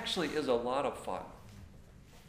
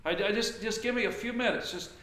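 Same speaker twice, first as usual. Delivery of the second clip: a touch higher and louder, flustered pleading; flustered husband; 6 semitones higher, 3 dB louder than usual. Retold or imitated. Frustrated husband asks his wife for space, mildly flustered. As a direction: act out a frazzled husband begging for space.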